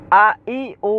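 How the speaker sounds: short vowels recited high; crisp Quran-lesson recitation; quick vowel drill. Shown as a demonstration. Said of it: "হারাকাতের উচ্চারণ তাড়াতাড়ি পড়তে হয়".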